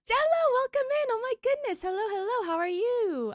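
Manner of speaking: high, loud delighted hello; excited greeting. Real, not acted. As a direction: greet them with a happy gasp. Greeting a new chatter.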